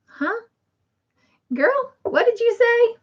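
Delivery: high, baffled huh and a quick question; puzzled huh. Real, not acted. Host confused by a viewer's odd chat message, high and puzzled. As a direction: squint at the screen, confused.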